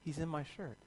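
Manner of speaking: quiet horrified whisper-like line; hushed alarm. Retold or imitated. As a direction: realise the wasp is inside your shirt. Feels wasp crawling.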